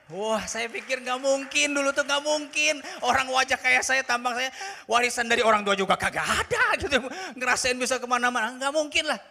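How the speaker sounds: loud, high, driving sermon voice; fervent pulpit delivery; full-force preaching. Acted. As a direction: preach it out with fire.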